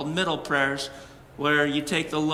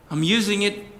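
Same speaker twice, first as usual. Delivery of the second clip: arguing with God; 7 semitones higher than usual. Retold or imitated. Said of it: "sitting there arguing".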